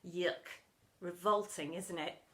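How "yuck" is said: usual speaking voice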